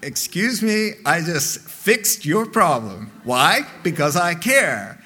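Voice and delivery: loud mock self-justification; hammed-up defensive protest; indignant husband. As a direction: act out an affronted husband arguing back. Husband argues back, acted.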